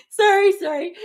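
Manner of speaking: high, squeaky sorry through leftover giggles; giggly high sorry; laughing apology. Real, not acted. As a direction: apologize while still cracking up. Apologizing while still laughing.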